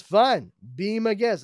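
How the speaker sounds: high, dismissive hero line; smug hero. Acted. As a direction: shrug it off smugly. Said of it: "Super Soldier says".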